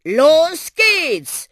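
loud, high, drawn-out battle cry; shouting. Acted. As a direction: yell it out to launch the trip. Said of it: "schrie der Buschi". (lauter, gedehnter Schrei)

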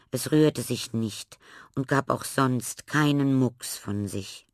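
ordinary delivery, nothing marked

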